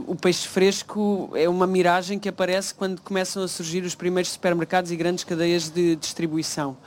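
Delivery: ordinary talk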